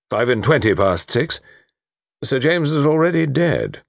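brisk chief constable thinking aloud; deducing colonel. Acted. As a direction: reason it out aloud, sure of it.

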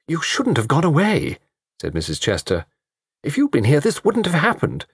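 put-on high, plaintive elderly lady; fretful reproach. A narrator's reading. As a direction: blame him plaintively. Mrs Chester reproaching.

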